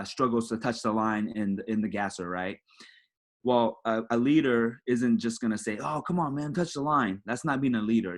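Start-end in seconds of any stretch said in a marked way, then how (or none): 5.8-7.2 higher-pitched nagging, no real volume; badly exasperated player, only half acted out; mild mock yell. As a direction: imitate a teammate yelling, without shouting. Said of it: "You're just yelling at them".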